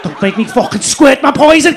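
dibuat lebih rendah seperti makhluk berbisa; venomous Scouser. Retold or imitated. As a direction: desis ancaman ala makhluk berbisa. Scouser threatening tourist.

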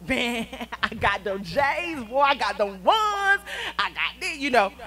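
loud, slow boastful show-off voice; bragging kid. Pretend. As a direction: brag like a kid flaunting new gifts.

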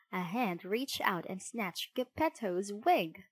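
usual speaking voice

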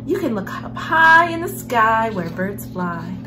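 ordinary delivery, nothing marked

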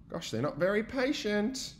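plain reading voice

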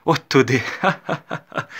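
loud high laugh through the words. A narrator's reading. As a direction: burst out laughing at the idea. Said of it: "засміялася Катерина".

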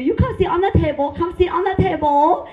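high, loud mimicked Vietnamese accent. Imagined gynecologist directing patient, high loud Vietnamese accent. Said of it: "I love their accent".

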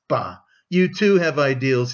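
ordinary delivery

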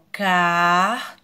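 car held long and loud; car, isolated and drawn out; single syllable demonstrated. Shown as a demonstration. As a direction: sound out car as its own syllable. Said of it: "ó, uma sílaba".

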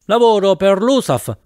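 high-pitched, contained yell. A narrator's reading. Professor pedalling furiously calls back to his passenger, yelling. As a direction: call it out while pedalling hard. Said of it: "mi urlò il professore pedalando accanitamente".

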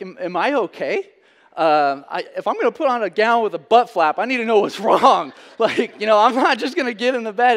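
high, loud, half-laughing worried pleading; a patient panicking about the gown, laughing through it; anxious, laughing plea. Retold or imitated. To the nurse, loudly and half-laughing, worried.

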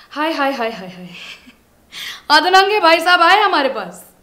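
loud, high theatrical announcement; mock fanfare.

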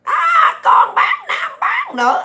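very high, drawn-out mimicking squeal; mock the hecklers in a slow falsetto shriek; hecklers' mock yelling. Ranter mimics critics yelling insults, mocking high-pitched yell. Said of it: "tụi bay la làng hoài".

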